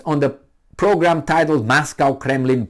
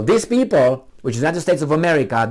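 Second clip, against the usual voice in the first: usual speaking voice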